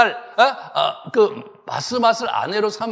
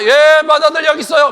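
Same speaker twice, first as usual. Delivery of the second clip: loud, high, put-on eager reply; eager Esau answering; 6 semitones higher, 9 dB louder than usual. Esau answering his father Isaac, a loud, high put-on eager voice.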